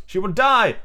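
high, emphatic DIE; alarmed warning. Acted. Toodles warning the boys about Wendy, alarmed. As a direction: raise the pitch in alarm on "she will die".

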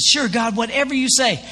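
mildly acted eager agreement; excited. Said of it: "the man's so excited".

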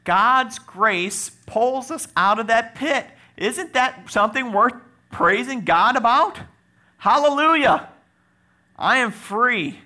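loud, high, praising cry; slow, ringing proclamation of praise; exultant full-force preaching. Acted. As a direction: raise the congregation with a jubilant shout.

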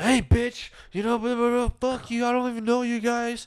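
hammed-up drunk guy telling them off; high mock drunk yell. Imitating drunk baby daddy yelling.

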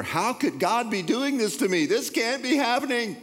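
high, squeaky panicked voice; panicked baby bird. Pretend. Panicked baby bird crying out to God, high and squeaky. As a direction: squeal it like a terrified little bird.

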